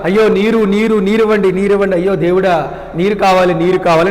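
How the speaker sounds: ordinary delivery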